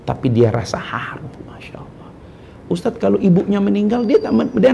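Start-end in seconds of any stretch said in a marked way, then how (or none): 0.1-2.1 soft whispered awe; whispered, almost breathless. Acted. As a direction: hush it with reverence. Hushed emotional point.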